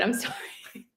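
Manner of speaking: soft, nervous apology; barely voiced sorry; quiet apology. Real, not acted.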